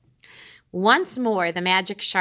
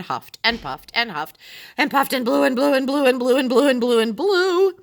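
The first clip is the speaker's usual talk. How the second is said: louder and higher, piling on the blows; mounting frenzy of huffing; frantic building; 6 semitones higher, 5 dB louder than usual. A narrator's reading. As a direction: race through the blowing, louder. Shark blowing extremely furious.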